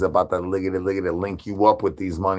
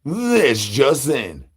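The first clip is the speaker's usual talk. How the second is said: mock newscaster; 3 semitones higher, much slower than usual. Mock news announcement.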